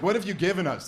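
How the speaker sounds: mock a nagging woman demanding an answer; pushy lady. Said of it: "that lady sounds pretty pushy".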